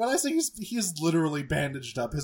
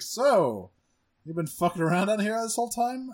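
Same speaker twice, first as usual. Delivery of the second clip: higher, louder mock scold; Megatron scolding; 8 semitones higher, 5 dB louder than usual. Megatron confronts Wheeljack, a hammed-up scold. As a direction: play a boss catching a slacker. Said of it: "and it's like".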